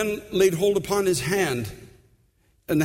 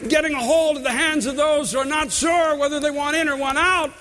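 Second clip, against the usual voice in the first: preaching at full force; 7 semitones higher than usual. Acted. Pastor urging the church to mentor, high and ringing. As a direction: build the sermon to a high, ringing call.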